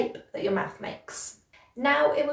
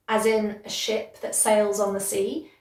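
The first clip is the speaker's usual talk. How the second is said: ordinary talk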